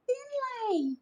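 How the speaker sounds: very high urgent cry of Gran; urgent call. A narrator's reading. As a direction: call the boy to turn round. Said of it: "Gran shouted".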